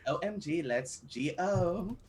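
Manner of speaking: put-on drawn-out Valley Girl accent, slow. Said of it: "you can just do a California Valley Girl accent".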